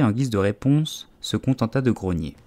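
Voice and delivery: usual speaking voice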